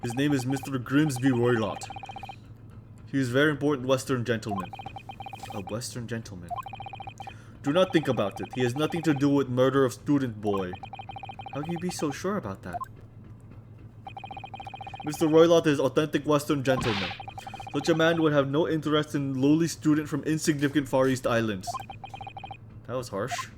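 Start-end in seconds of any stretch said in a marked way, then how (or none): 0.0-4.7 Russian crewman. Acted. Stroganoff voice. 7.6-21.7 put-on loud, heavy Russian accent; Russian crewman. Crewman introducing himself to visitors, loud Russian accent. Stroganoff voice.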